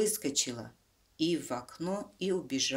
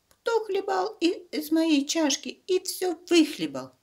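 much higher, louder thin squeak; squeaky bear cub; 8 dB louder than usual. Acted. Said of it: "запищал тонким голосом".

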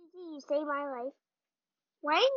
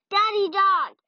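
enraged boss roar; 3 semitones higher, 10 dB louder than usual. Acted. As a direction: threaten like a game boss. Villain threat in game.

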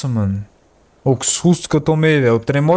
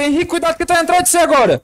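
urgent warning; 4 dB louder than usual. Real, not acted. Warning friend of monster.

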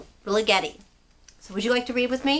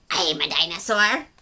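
snappy creature; 5 semitones lower than usual. Acted. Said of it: "the creature snapped".

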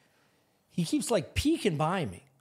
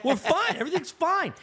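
high-pitched, laughing insistence; exasperated reassurance; 9 semitones higher than usual. Retold or imitated. Homeowner to girlfriend who fears they got robbed, exasperated reassurance. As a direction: insist it frantically, voice way up.

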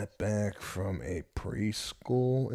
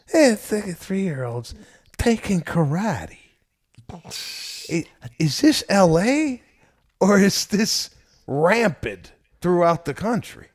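incredulous; 11 semitones higher, 7 dB louder than usual. Real, not acted. Dad to friend, amazed at the preschool's karate class, incredulous high-pitched exclaiming. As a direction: squeak out the question like you can't believe it.

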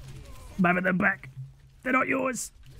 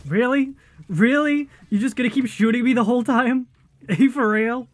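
louder, higher really; indignant loud complaint; exasperated shout; 6 semitones higher, 5 dB louder than usual. Real, not acted. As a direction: protest at the enemy. Complaining at being shot.

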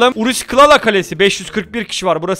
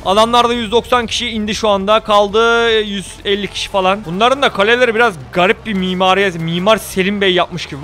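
ordinary talk

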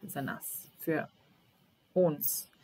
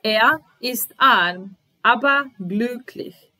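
slow, louder and higher, every word spaced out; slow model reading; 5 semitones higher, 7 dB louder, slower than usual. Shown as a demonstration. Teacher to learners, first slow louder model reading. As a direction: dictate it slowly so learners can follow. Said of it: "teacher models sentence for learners".

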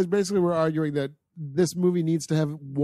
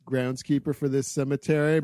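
usual speaking voice

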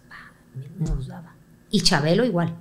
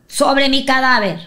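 defiant vow; 8 semitones higher, 11 dB louder, slower than usual. Retold or imitated. Mother's defiant vow retold.

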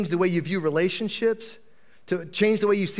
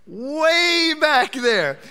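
excited; 9 semitones higher, 4 dB louder, much slower than usual. Real, not acted. Spotting a raised hand.

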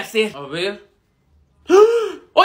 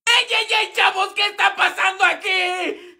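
teacher calling out; 6 semitones higher than usual. Acted. Teacher breaks up fight.

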